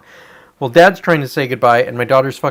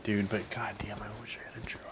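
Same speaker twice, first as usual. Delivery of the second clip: hushed unvoiced whisper; a real muttered wish, whispered; whispered aside; 5 semitones lower, 19 dB quieter than usual. Real, not acted. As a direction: drop to a whisper under your breath. Muttered aside about wanting a job.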